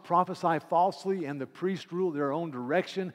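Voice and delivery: loud preacher reading scripture; preaching. Acted. Preacher to congregation, proclaiming scripture. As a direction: proclaim it from the pulpit.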